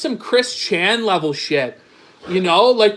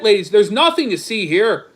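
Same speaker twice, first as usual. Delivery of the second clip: ordinary talk, nothing marked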